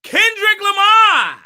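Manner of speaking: high, loud, drawn-out name call; big stretched-out topic announcement; announcer shout. Pretend. Host calls out first topic.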